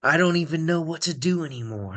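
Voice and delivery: booming villain voice. Pretend. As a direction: greet the fans like a villain. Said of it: "I'm just going to try out various villain voices".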